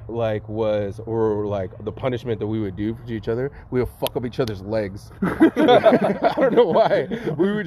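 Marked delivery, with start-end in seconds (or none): 5.2-7.7 higher, slow, laughing it out; giggling while admitting it. Real, not acted. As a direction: laugh through the line. Laugh heard, far, high.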